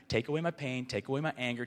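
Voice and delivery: ordinary talk, nothing marked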